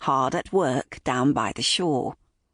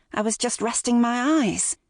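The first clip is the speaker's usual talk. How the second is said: higher-pitched defensive excuse; protesting; 7 semitones higher than usual. A narrator's reading. Mother excusing her nap to her son, protesting. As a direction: insist on it, pitched up. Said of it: "Holly protested".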